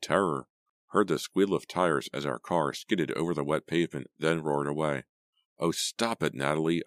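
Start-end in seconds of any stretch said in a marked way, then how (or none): none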